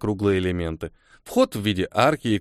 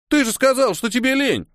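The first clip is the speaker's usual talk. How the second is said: indignant; 13 semitones higher than usual. A narrator's reading. Tolya accusing Niel, high indignant outburst. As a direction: call him out, outraged. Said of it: "возмутился Толя".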